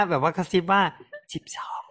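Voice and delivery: whispered test numbers; right in your ear. Shown as a demonstration.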